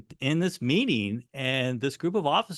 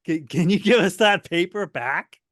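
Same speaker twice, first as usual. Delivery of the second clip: higher, louder sheepish plea from the officers; 6 semitones higher, 6 dB louder than usual. Retold or imitated. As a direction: ask for the paper back like caught schoolboys. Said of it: "And then they go".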